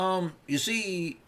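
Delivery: high, slow Bill Cosby voice; Bill Cosby impression. Mocking conservatism to chat in a high, slow Bill Cosby voice. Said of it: "I'm gonna do, like, a Bill Cosby voice".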